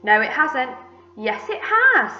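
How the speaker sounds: loud contradiction climbing high; bickering. A narrator's reading. Two aunts disagreeing over the peach, bickering. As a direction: argue back and forth, topping the last.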